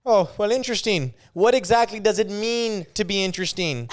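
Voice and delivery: Jordan Peterson. Acted. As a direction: riff earnestly, picking the concept apart. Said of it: "I can do Jordan Peterson really well".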